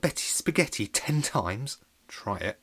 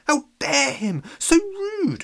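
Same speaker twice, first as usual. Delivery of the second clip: much higher, loud indignant squeal; indignant villagers; 5 dB louder, slower than usual. Acted. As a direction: squeal it in shocked outrage. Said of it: "they cried".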